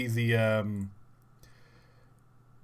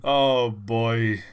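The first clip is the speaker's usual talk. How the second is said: ordinary talk, nothing marked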